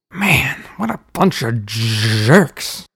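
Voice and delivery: irritated grumble, stretching the last word; snapped. A narrator's reading. Uncle as the door shuts behind him, snapped. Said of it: "Uncle Shameless snapped".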